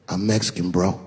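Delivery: low, casual husband's reply; cool, unbothered. Acted. Husband answering where he's from, cool about it. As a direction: toss it off low and easy. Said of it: "was just cool about the shit".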